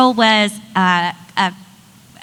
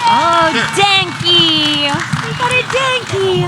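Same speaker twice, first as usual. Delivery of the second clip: long drawn-out, higher-pitched greeting; delighted calling out; 5 semitones higher, slower than usual. Real, not acted. Host spots a Denki in the crowd, delighted calling out. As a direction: stretch the name out in delight. Host calls out a cosplayer in audience.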